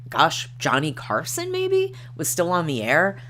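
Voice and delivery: normal talking voice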